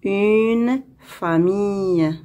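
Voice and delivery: slow, stretched "une", then "famille"; slow model reading. Shown as a demonstration. Teacher reading for pupils to hear the sound, slowly. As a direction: read it out slowly, word by word. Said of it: "On va lire".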